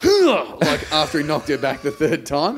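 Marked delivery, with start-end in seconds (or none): 0.0-0.6 high, loud grunt like being punched; winded grunt. Retold or imitated. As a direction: gasp as if winded.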